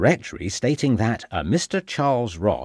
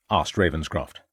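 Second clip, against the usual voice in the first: ordinary delivery, nothing marked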